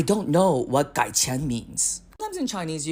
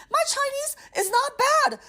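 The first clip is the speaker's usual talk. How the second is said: mock indignant; 4 dB louder than usual. Comic rant climax.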